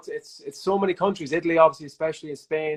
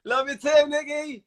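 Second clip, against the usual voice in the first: high, sweet lovey-dovey coo; giggly late night call; 11 semitones higher, slower than usual. Real, not acted.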